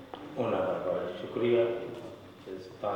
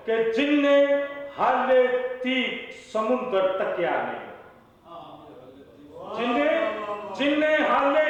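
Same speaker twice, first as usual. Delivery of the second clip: high-pitched declaimed Punjabi verse; poetry recital; 5 dB louder than usual. Acted. Poet reciting couplets at a mushaira, declaimed recital. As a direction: recite phrase by phrase, repeating lines for the audience.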